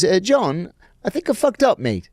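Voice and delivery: high-pitched British accent impression; mock-British voice, pitched high; thick British accent. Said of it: "he has a very thick British accent".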